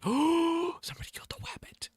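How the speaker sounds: hushed gasp, then whispered. Acted. Whispering in mock shock over the dead rabbit. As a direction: breathe it out in shock. Mock Elmer Fudd lament.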